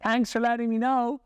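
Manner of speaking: high, breezy mock gratitude; chirpy mock thanks at a fire warning. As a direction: parody a clueless cheerful thank-you. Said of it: "And you basically go".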